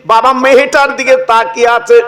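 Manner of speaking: lilting, chanted preacher's telling; sermon storytelling. Acted. Preacher telling the crowd a saint's tale, chanted sermon voice.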